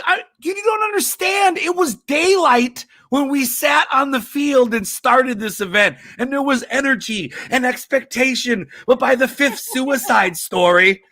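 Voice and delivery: high, panicked thought said aloud; mock panic over losing the crowd; panicked inner voice. Comedian panicking as the crowd leaves the stadium. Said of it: "I'm like".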